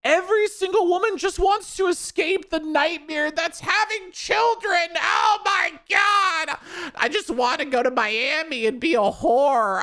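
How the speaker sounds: mock whiny mom. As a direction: parody a melodramatic mother lamenting her life. Mocking mothers who hate motherhood.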